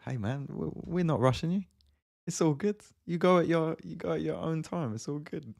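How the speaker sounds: high, easygoing reassurance; reassuring. Real, not acted. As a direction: put the viewer at ease.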